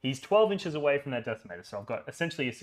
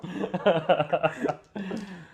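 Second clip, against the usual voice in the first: laughing; 6 semitones higher, 3 dB louder than usual. Real, not acted. Laughing after inhaling bug.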